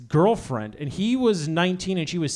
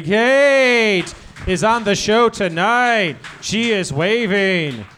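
slow host announcement; 4 dB louder, much slower than usual. Acted. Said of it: "announcing guest".

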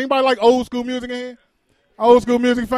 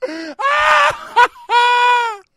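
high screaming laugh; shrieking laugh; 12 semitones higher, 3 dB louder than usual. Real, not acted. Laughing after fan scream.

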